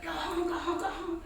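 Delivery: retold high panicked yell at the dog. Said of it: "she was screaming in a panic".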